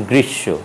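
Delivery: গ্রীষ্ম with doubled "sh", no m; গ্রীষ্ম ending in long "shsho"; silent m. Shown as a demonstration. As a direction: say গ্রীষ্ম as "grish-sho". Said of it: "উচ্চারণ হবে".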